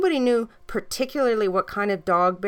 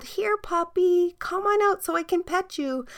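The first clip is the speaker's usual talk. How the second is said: put-on little girl coaxing the puppy out, high sweet call; 11 semitones higher than usual. A narrator's reading. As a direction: coax the puppy out gently. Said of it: "The girl called".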